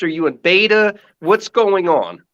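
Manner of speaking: normal talking voice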